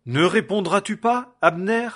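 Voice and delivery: high and loud, hailing across the valley; loud shout to a distant camp; calling out. A narrator's reading. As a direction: call out from the mountaintop. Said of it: "Et David cria au peuple".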